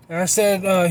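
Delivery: ordinary talk, nothing marked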